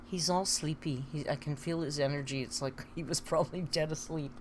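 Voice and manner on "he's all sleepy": ordinary talk, nothing marked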